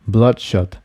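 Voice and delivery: usual speaking voice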